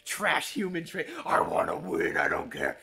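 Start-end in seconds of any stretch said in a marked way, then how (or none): 1.2-2.8 low, mocking whine of a selfish player; mocking greedy gamer. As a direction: sneer it like a greedy cheater. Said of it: "Self-gain".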